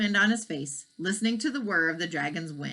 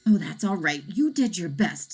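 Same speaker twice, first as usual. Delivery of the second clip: usual speaking voice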